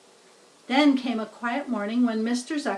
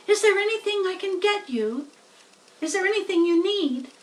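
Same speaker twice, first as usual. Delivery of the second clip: put-on higher, louder young pig voice; a pig meeting his friend's babies, fussing kindly; Wilbur the pig, eager; 8 semitones higher, 3 dB louder, faster than usual. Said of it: "said Wilbur".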